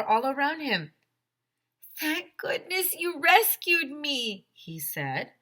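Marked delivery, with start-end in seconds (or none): 2.0-4.5 high, slow relieved voice; relieved old man voice. A narrator's reading. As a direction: sigh it out with relief. Freed captive thanks kids.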